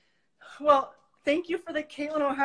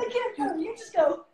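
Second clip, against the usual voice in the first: strained laughing; 9 semitones higher, 3 dB louder than usual. Real, not acted. Exhausted during fast crunches.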